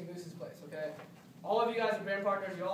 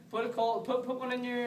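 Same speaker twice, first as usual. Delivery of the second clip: usual speaking voice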